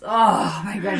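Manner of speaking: worn-out, groaning mock exhaustion; imitating someone too tired to go on. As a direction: groan it like a spent swimmer. Acts the exhausted diving girl.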